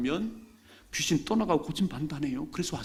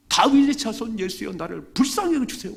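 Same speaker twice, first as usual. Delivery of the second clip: high, loud desperate cry; louder cry; 9 semitones higher, 7 dB louder than usual. Acted. Blind man shouting louder over the crowd to Jesus. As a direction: scream it out even louder. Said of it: "더 크게 소리했어요".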